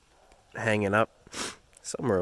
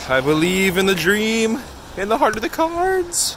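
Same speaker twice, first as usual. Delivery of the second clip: hammy anime hero; 13 semitones higher, 8 dB louder than usual. Pretend. Quoting an anime catchphrase.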